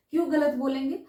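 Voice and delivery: normal talking voice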